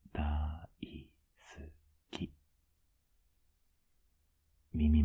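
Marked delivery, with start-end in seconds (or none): 0.1-2.3 slow, separated syllables with pauses; syllable-by-syllable confession. Acted. Boyfriend confessing love to flustered partner, slow and broken into beats. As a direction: spell out the affection beat by beat.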